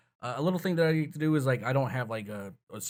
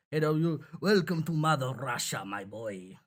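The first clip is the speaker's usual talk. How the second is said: Russian accent. Shown as a demonstration. Showing how Victor should sound, makeshift Russian accent. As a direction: throw on a hammy Russian accent. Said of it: "gotta be able to do a Russian accent".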